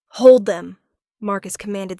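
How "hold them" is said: much louder, firm order; loud command. A narrator's reading. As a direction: order them held back. Marcus commanding someone.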